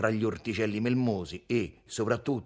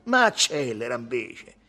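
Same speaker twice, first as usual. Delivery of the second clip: raised, acid command; sour; 2 semitones higher, 6 dB louder than usual. A narrator's reading. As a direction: counter sourly, a bit louder. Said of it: "fece acido Tommaso".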